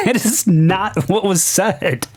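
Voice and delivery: high, laughing disbelief; real incredulous laughter. Real, not acted. Reviewer objecting to the comic's leap in logic, laughing incredulously. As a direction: protest through helpless laughter.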